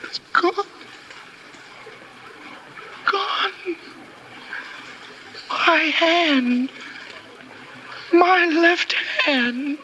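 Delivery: high, broken disbelief over the lost hand; stunned horror. Acted. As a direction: voice climbing in shock, slow on the last words. Discovers his hand amputated.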